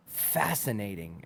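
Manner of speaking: low, soft, slow deadpan; deadpan fascinated. Pretend. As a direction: say it like a scientist observing. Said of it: "walk up to them and then go".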